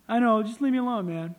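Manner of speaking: loud, acted exasperation; imitating someone who wants to be left alone; exasperated brush-off. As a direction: cut them off loudly, fed up. Acted brush-off in retold exchange.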